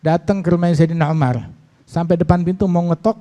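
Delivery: ordinary talk, nothing marked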